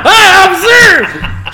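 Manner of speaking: high, loud bright greeting with a smile; cheerfully clueless. Retold or imitated. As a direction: chirp it out, all innocence. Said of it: "sitting there smiling".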